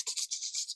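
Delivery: quiet, breathless clicking "t-, t-, t-" like a radio scanner; scanning click sound. Shown as a demonstration. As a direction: tick it out softly like a scanning radio. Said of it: "where it goes t".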